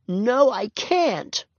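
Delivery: put-on high, very slow small little-girl voice. A narrator's reading. Ramona refusing her sister, small and forlorn. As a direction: say it tiny and forlorn. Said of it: "said Ramona in a small voice".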